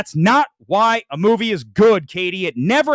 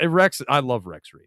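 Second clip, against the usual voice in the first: ordinary talk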